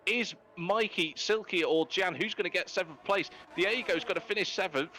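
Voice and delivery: high-pitched, excited motorsport commentary; live finish-line play-by-play. Real, not acted. Commentator announces the race winner and podium, excited play-by-play. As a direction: call the finishers home, pitch riding high.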